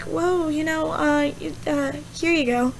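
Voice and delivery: soft, hesitant placating reply; gentle answer. Retold or imitated. Answering someone who is angry at you, gently placating. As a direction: back off quietly and hand it over. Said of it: "Gentle answer turns away wrath".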